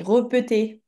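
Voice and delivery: "repeter" with schwa in the first two syllables, re-pe-té; misspelt reading. Shown as a demonstration. Teacher reads back the pupil's misspelling, demonstrating the error. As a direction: read "repeter" as written without accents. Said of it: "Ici, t'as écrit repeter".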